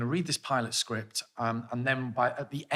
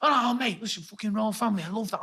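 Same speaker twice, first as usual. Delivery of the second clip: higher, lightly matey voice; a hint of an eager bloke; imitating Will; 6 semitones higher than usual. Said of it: "He goes".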